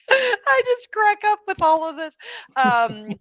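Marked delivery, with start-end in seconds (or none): 0.0-2.1 high, laughing burst. Real, not acted. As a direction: crack up as you say it. Laughing at a joke.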